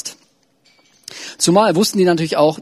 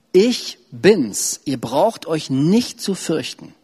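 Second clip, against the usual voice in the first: slow reassurance; much slower than usual. Acted. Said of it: "Aber Jesus sprach sie sofort an".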